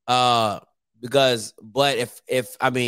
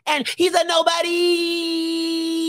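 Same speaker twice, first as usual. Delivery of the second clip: very high, slow, called-out imitation; mock high shout as the friend would; Timona's high-pitched voice; 11 semitones higher, much slower than usual. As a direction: drag it out high like teasing a friend. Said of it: "that's what Timona would say".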